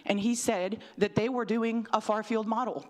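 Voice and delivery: ordinary delivery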